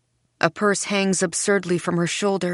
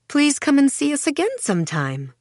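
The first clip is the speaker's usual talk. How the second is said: high, gushing shopkeeper's send-off; sugary, higher-pitched invitation; urging; 8 semitones higher than usual. A narrator's reading. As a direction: coax the customer to come back. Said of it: "she urges the woman".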